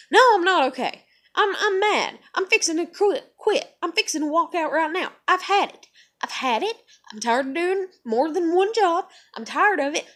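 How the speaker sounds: loud, high fed-up outburst; going off. Retold or imitated. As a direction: let the manager have it. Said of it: "from where I did go off".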